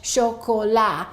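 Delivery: 'chocolat' with three full vowels and silent t; French pronunciation. Shown as a demonstration. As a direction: say 'chocolat' slowly the French way, sho-ko-la. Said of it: "or in French".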